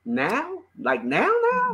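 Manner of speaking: stunned, high disbelief; shocked disbelief. Real, not acted. Reacting to the all-caps comment.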